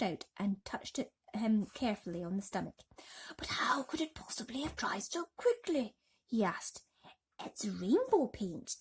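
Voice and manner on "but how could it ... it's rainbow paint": hushed whisper. Acted. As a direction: whisper it, barely voiced. Grasshopper asks hushed.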